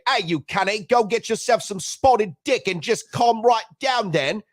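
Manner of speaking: high, slow put-on British voice; mock Cockney taunt; mock British accent. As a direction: ham up a cheeky English insult. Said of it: "this is what you say to somebody from the U K".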